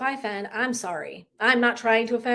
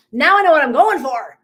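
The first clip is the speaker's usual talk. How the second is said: loud, higher, fired-up declaration; emphatic realization; 4 semitones higher, 8 dB louder than usual. Retold or imitated. Planning to host retreats herself, loud excited realization. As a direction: belt it out like a breakthrough.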